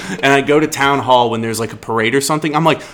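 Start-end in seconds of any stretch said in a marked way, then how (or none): none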